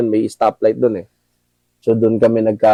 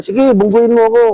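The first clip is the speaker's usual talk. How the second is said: higher, louder taunting challenge; provoking dare; 11 semitones higher, 4 dB louder than usual. Retold or imitated. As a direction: dare the car to hit you, mocking. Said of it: "pinrovoke niya pa yung sasakyan".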